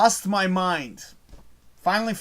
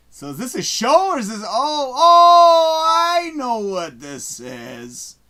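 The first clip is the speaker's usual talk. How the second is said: laughing realization; 7 semitones higher, 9 dB louder, much slower than usual. Real, not acted. Realizing what the show is.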